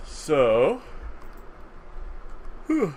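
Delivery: speaking mid-yawn. Real, not acted. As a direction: talk through a yawn. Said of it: "sorry for the yawning".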